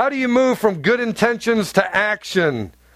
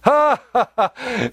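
mock laugh; 4 semitones higher, much slower than usual. Mocking laugh of crowd.

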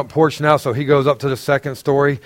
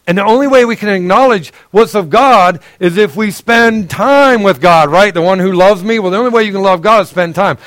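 preaching; 5 semitones higher, 4 dB louder than usual. Acted. Preaching again.

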